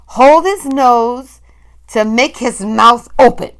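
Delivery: slow, loud witch relishing the moment; gloating witch. A narrator's reading. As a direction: drawl it with cruel delight. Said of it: "and she said".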